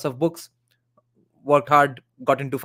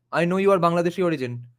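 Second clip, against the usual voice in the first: ordinary delivery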